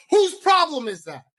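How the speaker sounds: loud, high-pitched indignant retort; sudden exasperated shout; indignant outburst. Real, not acted. Indignant retort to the booker's excuse.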